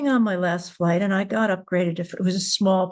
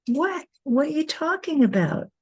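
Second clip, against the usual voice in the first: baffled; 8 semitones higher than usual. Retold or imitated. Baffled reply to sudden accusation.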